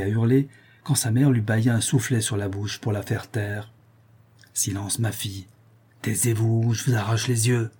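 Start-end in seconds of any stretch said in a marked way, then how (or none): 4.5-7.7 muttered scolding. A narrator's reading. Mother hushing her daughter after a slap, understated muttered scolding. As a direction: grumble it lightly through gritted teeth. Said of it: "maugréa la mère entre ses dents".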